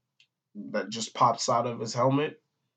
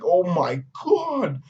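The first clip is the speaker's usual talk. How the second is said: drawn-out, higher-pitched imitated dismay; mock shock; 5 semitones higher, slower than usual. Crew seeing the fly attack, imitated shock. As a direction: stretch it out as mock horror.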